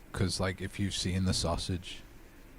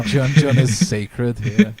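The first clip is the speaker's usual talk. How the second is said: slow, loud mock-solemn decree; loud proclamation; 5 semitones higher, 13 dB louder, slower than usual. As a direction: declare it like holy law.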